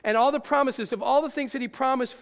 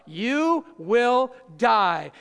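very slow and loud, each word dragged out; solemn warning; 7 dB louder, much slower than usual. Acted. Preacher stressing God's warning to Eve, slow and loud. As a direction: spell out the warning word by word, heavy.

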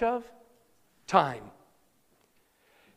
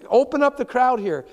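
higher, louder calling through a crowd; impatient father; 4 semitones higher, 5 dB louder than usual. Retold or imitated. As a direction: push through, pleading to move. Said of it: "Almost like".

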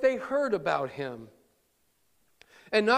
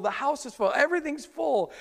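ordinary delivery

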